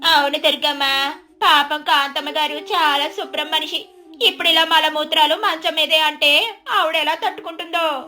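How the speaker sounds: second neighbour. Acted. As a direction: agree with gossipy sympathy.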